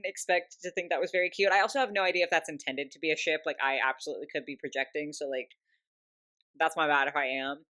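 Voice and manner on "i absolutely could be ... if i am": normal talking voice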